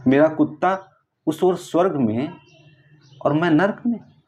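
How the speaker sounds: aggrieved protest. Acted. Dead man complaining to God about his dog in heaven, aggrieved.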